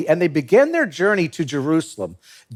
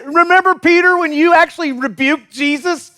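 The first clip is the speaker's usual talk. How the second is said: mock a buddy who blew it; teasing disciples; 10 semitones higher than usual. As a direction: friends razzing Peter, pitched way up. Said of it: "they probably were like".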